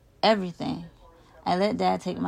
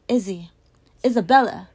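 put-on loud call after a runaway child; urgent parent's shout; 4 semitones higher, 9 dB louder than usual. A narrator's reading. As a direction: call the name out, louder the second time. Parent calling after fleeing child.

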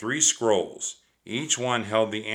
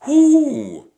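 loud, high owl's hoot; a sudden hoot in the dark woods; 11 dB louder than usual. A narrator's reading. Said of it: "Jack jumped in fear".

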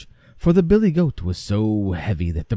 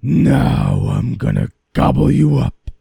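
menacing troll; 9 semitones higher, 10 dB louder, faster than usual. Acted. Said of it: "said the troll".

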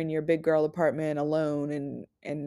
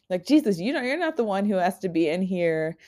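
ordinary talk, nothing marked

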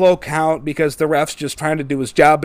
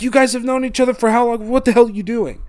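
higher-pitched, incredulous appeal; exasperated referee; 6 semitones higher, faster than usual. Retold or imitated. Ref trying to calm two friends intent on fighting, exasperated. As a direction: plead as a baffled ref, pitch up. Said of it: "trying to get them to calm down".

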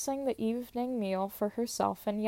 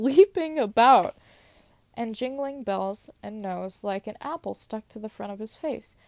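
plain reading voice

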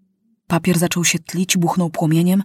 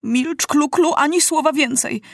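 higher, urgent hushing call; 8 semitones higher than usual. A narrator's reading. Maciuś calls out to silence Klu-Klu, higher. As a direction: cut her off urgently. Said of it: "zawołał Maciuś".

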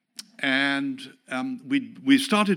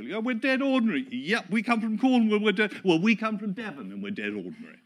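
mock proud locals; 8 semitones higher than usual. Churchgoers boasting they are ordinary, lightly voiced cheery locals. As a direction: play plain-spoken locals owning it.

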